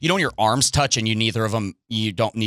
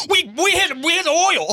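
very high, strained cry of triumph; excited oil rig worker; 3 dB louder than usual. Retold or imitated. Mimicking oil workers striking it rich, high-pitched whoop. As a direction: squeal it like a driller striking oil. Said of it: "like, an oil rig's like".

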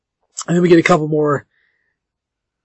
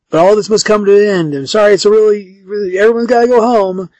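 raised, higher voice to a crowd; mock announcement; 6 semitones higher, 4 dB louder than usual. Clay as producer sending party guests home, mock announcement. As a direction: call out an apologetic send-off, lightly acted.

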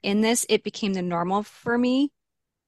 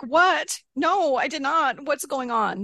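shocked disbelief; 6 semitones higher, slower than usual. Retold or imitated. Stunned by the cops message.

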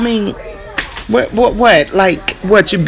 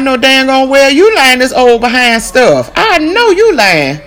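higher, louder, calling it out; indignant outburst; 9 semitones higher, 5 dB louder than usual. Retold or imitated. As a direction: accuse the landlord loudly. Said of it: "you be like".

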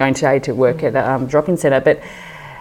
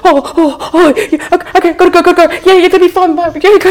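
much higher and louder, tumbling over himself; teary father flustered by the marriage request; flustered dad; 8 dB louder, faster than usual. Retold or imitated. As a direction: rush off overwhelmed with emotion. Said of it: "He goes".

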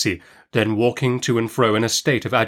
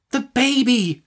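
high-pitched impatient clarification; sharp, insistent aunt; 12 semitones higher, slower than usual. A narrator's reading. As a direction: spell it out with exasperation. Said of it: "said my aunt sharply".

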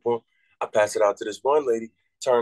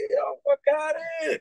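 much higher, amazed outburst; stunned excitement. Retold or imitated. Watching Shaq enter to his song, amazed. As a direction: let the disbelief jump up high.

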